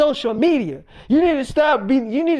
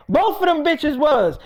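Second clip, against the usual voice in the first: usual speaking voice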